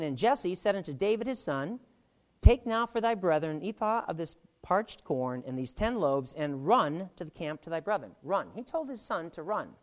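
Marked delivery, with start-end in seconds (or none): 0.0-8.1 raised, declaiming Bible reading voice; scripture reading. Acted. Teacher reading Jesse's words to the class, declaiming. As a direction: proclaim the verse to the room.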